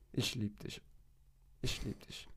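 giving in to the sad-eyed dog; soft murmur. Retold or imitated. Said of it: "muss ich sagen". (leise, fast geflüstert)

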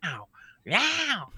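high, crying meows; yowling cat. Retold or imitated. Imitating the cat screaming at the family, high yowling meows. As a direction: wail it like an upset cat. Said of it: "just screaming at them".

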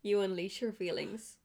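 usual speaking voice

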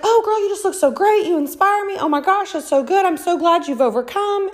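high, effusive mock praise; imitating gushing admirers. Gushing admirers voiced in her story.

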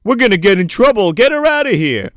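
high, loud urgent order; acted alarm, hurrying everyone along; mock panic. Frat guy fearing trouble over underage girl, mock panic.

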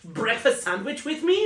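high, loud, silly Norwegian voice; hammed-up Norwegian pickup line; mock Norwegian accent. Comic mimics hot Viking ruining it by talking, silly Norwegian accent. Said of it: "Norwegians are so hot until we open our mouth".

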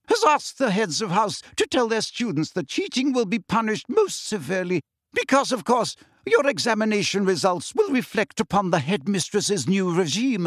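brisk and formal, lay down the rules; stern teacher. Acted.

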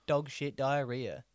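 loud, slow mock news delivery; hammed-up reporter voice; news reporter parody. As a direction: announce it slowly, with absurd gravity. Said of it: "they've all got that same voice".

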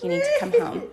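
wife calling her husband home mid-fight, high and urgent; urgent plea. Retold or imitated. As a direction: beg him to come home, high and tight. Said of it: "I was like".

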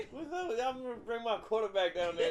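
Deion Sanders voice. Retold or imitated. Prank caller as Deion Sanders, loud high mock voice. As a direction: keep the goofy Deion act going through laughs. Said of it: "That's how he talks".

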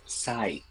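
"sai" with a clear s, like sigh; falling "sai" with an unvoiced s; S sound. Shown as a demonstration. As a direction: say "sai" starting on S, not Z. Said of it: "but it says S".

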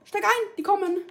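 loud, high, panicked yell; urgent shouting. Real, not acted. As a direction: yell at him to get in now. Enemies coming, urgent call.

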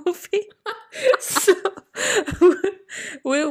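bursts of high, loud laughter; cracking up between words. Real, not acted. Co-host laughing at the friend forgetting the film.

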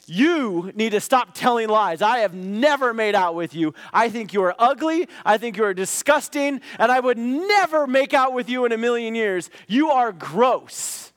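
loud, high, furious telling-off; retold teenage rant, loud and high; angry outburst. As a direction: blast her with outrage. Confronting Nicole angrily in class.

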